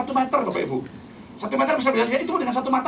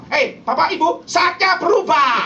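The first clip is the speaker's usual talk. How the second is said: lightly acted, raised call from God; God's imagined answer; 4 semitones higher, 5 dB louder, slower than usual. God calling to sleeping couple at midnight, mock proclamation. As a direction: call out the imagined divine announcement, slowing at the end. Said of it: "Tuhan datang bilang apa?".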